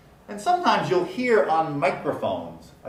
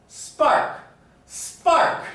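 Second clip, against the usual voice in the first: loud, forceful SPARK with no puff on the P; loudly; 13 semitones higher, 6 dB louder than usual. Shown as a demonstration. Teacher showing volume adds no aspiration, loud spark. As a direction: belt out spark, keeping the P unaspirated. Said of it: "even loudly pronouncing it".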